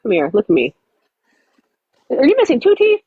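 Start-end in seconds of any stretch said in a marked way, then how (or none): 2.1-3.0 high shocked squeak to the puppy; shocked squeal. Real, not acted. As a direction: gasp it out at the dog's missing teeth. Shocked at dog's teeth.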